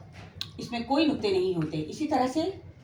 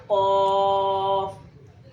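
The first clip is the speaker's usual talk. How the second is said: कॉफ held very long; slow letter name; 5 dB louder, much slower than usual. Shown as a demonstration. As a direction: stretch कॉफ out for pupils. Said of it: "नाम बन गया कॉफ". (कॉफ को बहुत खींचकर)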